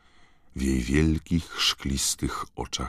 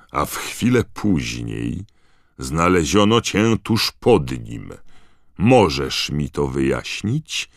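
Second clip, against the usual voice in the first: slow, loud, weighty accusation; stern; 2 semitones higher, 7 dB louder than usual. A narrator's reading. Digory demanding the elf explain herself, stern. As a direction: press the accused with measured force. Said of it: "powiedział pan Digory surowym tonem".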